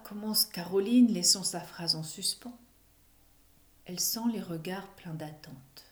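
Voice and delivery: plain reading voice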